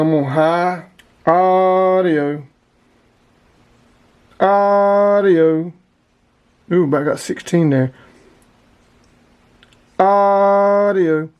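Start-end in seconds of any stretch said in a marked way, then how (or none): 1.3-5.7 long, raised "Audio" called into the mic; drawn-out radio test call. Real, not acted. Keying the radio for the power test, a long raised call. 10.0-11.3 long, raised "Audio" called into the mic; drawn-out radio test call. Real, not acted. Keying the radio for the power test, a long raised call.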